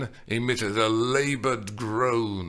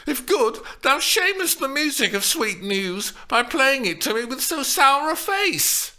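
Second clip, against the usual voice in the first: put-on scolding girl; 5 dB louder than usual. A narrator's reading. Juliet scolding the nurse.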